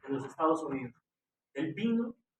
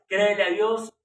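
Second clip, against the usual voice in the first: "Créele a Dios" much louder, with a rise on "Créele"; louder plea; 2 semitones higher, 10 dB louder than usual. Shown as a demonstration. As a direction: urge the listener, with force behind "Créele". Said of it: "Créele a Dios por más".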